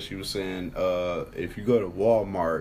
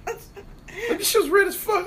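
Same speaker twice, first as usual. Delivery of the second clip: laughing high; 4 dB louder, slower than usual. Real, not acted. Laughing while retelling shock.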